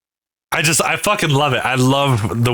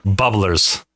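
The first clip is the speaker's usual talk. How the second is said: ordinary delivery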